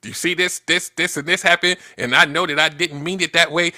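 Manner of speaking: hammed-up know-it-all arguing her side; mock fact checker. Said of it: "the fact checker".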